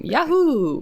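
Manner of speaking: high sarcastic whoop; mock cheer. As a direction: cheer it with fake joy.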